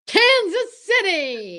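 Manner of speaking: slow, stretched, loud cry; exaggerated shout. Costello bellows the punchline to Abbott, slow and loud. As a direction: bellow it out like a revelation. Comic punchline about whizzing object.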